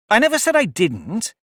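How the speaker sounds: interrupting. A narrator's reading. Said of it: "interrupted Alice".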